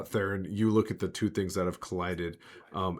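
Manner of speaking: ordinary delivery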